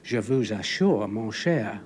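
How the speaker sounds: slow, loud French in a thick English accent; French with a bad English accent. Shown as a demonstration. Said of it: "if I was to speak French to you, of course, it would sound much the same".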